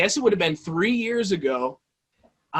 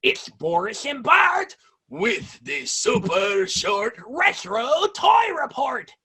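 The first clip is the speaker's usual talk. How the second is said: show intro voice; 7 semitones higher, slower than usual. Shown as a demonstration. YouTuber redoing his old toy-show intro, hammed-up announcer shout. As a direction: belt out the show title like a hammy TV host. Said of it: "let me see if I can do it".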